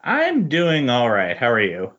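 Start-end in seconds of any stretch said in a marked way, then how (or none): none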